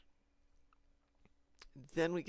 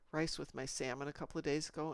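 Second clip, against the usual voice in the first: usual speaking voice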